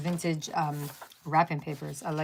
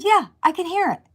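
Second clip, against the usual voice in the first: exasperated; 10 semitones higher than usual. Retold or imitated. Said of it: "I said".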